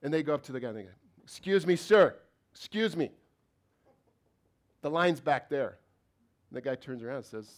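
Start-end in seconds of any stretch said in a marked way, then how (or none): 1.2-5.8 sharp, loud, high indignant "excuse me"; irate customer. Retold or imitated. Angry customer scolding the man who cut the line, loud and high.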